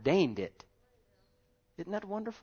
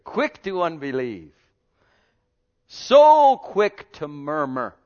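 loud, slow pulpit emphasis; slow emphatic preaching; 10 dB louder, slower than usual. Acted. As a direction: drag out each charge.

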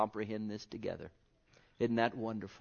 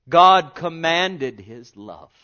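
slow, loud proclamation; proclaiming scripture; 3 semitones higher, 14 dB louder, much slower than usual. Acted. Preacher reading Romans to congregation, slow and loud. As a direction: stretch each word out.

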